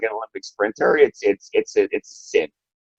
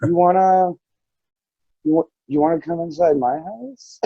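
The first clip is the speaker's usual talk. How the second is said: hesitant, coy invitation with a pause after the first try; imitated coy boy, rising at the end; coy little kid; 3 semitones higher than usual. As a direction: play a shy child fishing for a yes. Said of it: "he starts to be coy about it".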